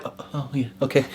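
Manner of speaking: 轻声慌乱地答应; startled. Retold or imitated. Startled when told to hand over.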